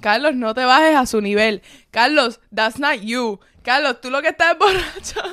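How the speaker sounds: loud, pleading and laughing through it; urging him to drop it, half laughing; hushing a friend. Retold or imitated. Telling drunk Carlos loudly to calm down, laughing. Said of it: "yo estaba al frente de Carlos así".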